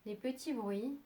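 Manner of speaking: soft, unhurried meditation voice with long pauses; calm yoga nidra guidance, leaving long silences; guided meditation. Acted. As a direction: guide them gently towards sleep, phrase by phrase.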